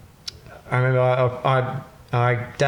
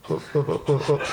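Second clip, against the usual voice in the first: stunned; 2 semitones higher, 4 dB quieter than usual. Retold or imitated. Stunned reaction to fine.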